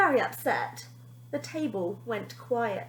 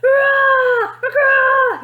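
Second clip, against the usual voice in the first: huge high roaring cheer; Dandelion roaring while chasing the children; 14 semitones higher, 14 dB louder, much slower than usual. A narrator's reading. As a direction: roar it out at full power. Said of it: "he roared".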